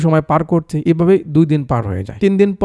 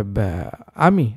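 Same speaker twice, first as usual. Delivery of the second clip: a slow, lower, drawn-out uncle voice; 7 semitones lower, 4 dB quieter, much slower than usual. Acted. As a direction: drag it out like a hesitant old uncle. Uncle answering police.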